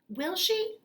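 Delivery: high hopeful question; eager child. Acted. Boy asking the nurse, high and eager.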